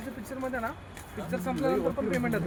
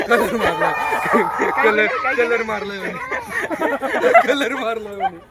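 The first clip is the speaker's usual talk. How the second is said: loud joking; 7 dB louder than usual. Real, not acted. Joking loudly about painted tiger.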